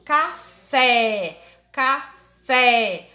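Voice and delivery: "café" with the final E wide open, loud and held; open vowel. Shown as a demonstration. Teacher demonstrates the acute accent, open stressed E in "café". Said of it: "e o som aberto".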